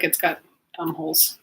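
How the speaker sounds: normal talking voice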